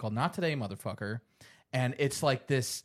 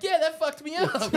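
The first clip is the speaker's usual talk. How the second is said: high-pitched mock-traumatized kid; ten-year-old self; 13 semitones higher, 4 dB louder than usual. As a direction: imitate a shaken child admitting it. Ten-year-old self after horror film.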